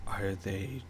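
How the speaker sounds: soft, slow, low noblewoman voice; Lady Trask. Acted. Baroness questions Lady Celine about unexpected company, hushed disapproval. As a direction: quietly question the company, drawing out the words. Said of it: "Lady Trask looks at all of you".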